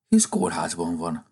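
ordinary delivery, nothing marked

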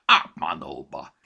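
loud, low, drawn-out curse; exasperated, slow congested oath; bitter cursing. A narrator's reading. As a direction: swear in despair through a blocked nose. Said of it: "káromkodott el keseredetten".

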